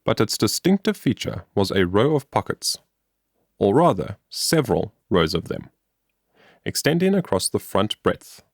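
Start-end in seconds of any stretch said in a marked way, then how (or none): none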